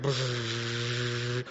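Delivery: long buzzing brrr; imitated brrr noise. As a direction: let out a drawn-out brrr. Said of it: "And he's like".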